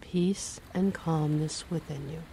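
ordinary talk, nothing marked

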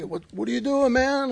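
high, startled street-buddy voice; buddy's surprised voice. Retold or imitated. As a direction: pitch it way up like a startled homie. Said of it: "He's all like".

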